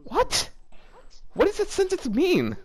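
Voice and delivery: can't believe what she just read; baffled disbelief. Real, not acted. Baffled by a confusing sentence.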